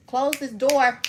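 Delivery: loud call to someone nearby. Real, not acted. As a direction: shout across the room. Calling out to someone.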